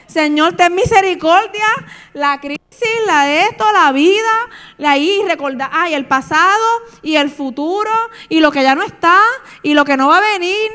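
loud, high mock wailing; melodramatic crying. Preacher imitating her weeping in the shower, hammed-up wailing. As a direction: ham up a tearful soap-opera lament. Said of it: "era llorando, tú sabes, fíjate, la Rosa de Guadalupe".

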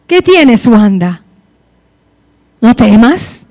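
half-scolding, pressing repeated question; reproachful question. Retold or imitated. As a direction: ask it like a reproach, rising in force. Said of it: "esa pregunta me parece un reproche".

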